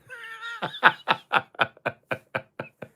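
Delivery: very high shrieking laugh; squealing laugh. Real, not acted. High squealing laugh after joke.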